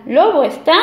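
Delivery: plain reading voice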